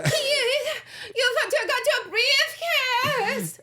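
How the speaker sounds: very high, drawn-out wail in a put-on accent; mock screaming in an accent. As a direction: shriek it slowly after someone, hammed-up accent. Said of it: "she's doing an incredible accent".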